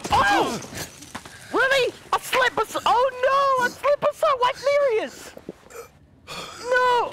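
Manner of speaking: over-the-top "oh no" outburst; mock distress. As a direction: cry out in hammed-up dismay. Acted distress beside game wife.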